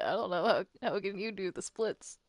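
normal talking voice

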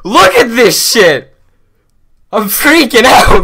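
ordinary talk

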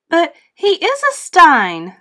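high, loud proud insistence; proud parents. A narrator's reading. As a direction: declare it with family pride.

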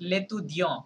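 লেতুদিয়ঁ with a nasal ending and no T; silent final T. Shown as a demonstration. French teacher shows students the masculine form, final T silent. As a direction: leave the last letter of লেতুদিয়ঁ unsounded. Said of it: "লাস্টের লেটারটা silent থাকবে".

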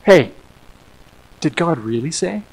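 soft, hushed tempter's murmur; insinuating whisper. Retold or imitated. Deceiver tempting our hearts with lies, hushed insinuation. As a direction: plant the doubt softly, almost whispering. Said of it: "it whispers in our ears".

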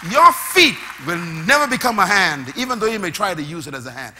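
loud, high preacher's cry; full-force preaching. Acted.

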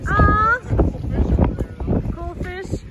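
usual speaking voice

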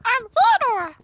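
very high, loud squeaky chipmunk; failed, over-pitched chipmunk impression. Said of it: "My chipmunk voice".

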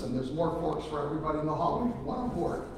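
usual speaking voice

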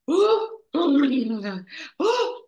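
high, loud mock gasps and wails; exaggerated gasping exercise; crazy acting-exercise noises. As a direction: gasp and wail like an odd drama warm-up. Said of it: "this crazy exercise".

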